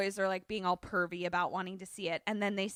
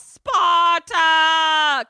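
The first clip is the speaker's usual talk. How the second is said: loud, high drawn-out war cry; yell; 7 semitones higher, 12 dB louder than usual. Retold or imitated. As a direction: bellow it like a battle cry. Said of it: "And then he yells".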